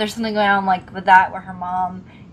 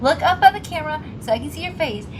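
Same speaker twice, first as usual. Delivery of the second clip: high-pitched impatient plea; mock pleading; 7 semitones higher than usual. Viewer begs the actor to look up, mock impatience. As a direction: urge the screen, pitched up high.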